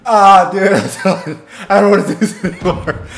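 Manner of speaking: loud groan breaking into laughs; laughing complaint. Real, not acted. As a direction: protest through pained laughter. Laughing while begging to stop.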